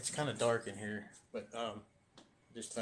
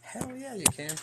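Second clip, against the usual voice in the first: excited; 11 semitones higher, slower than usual. Real, not acted. Asked to show his drawings.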